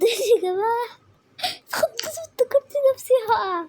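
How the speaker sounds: high, loud startled yelp. Real, not acted. Gamer telling viewers she got scared, high startled cry. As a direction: cry out as if you nearly fell. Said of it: "فاتخضيت".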